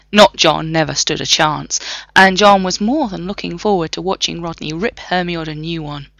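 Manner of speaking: plain reading voice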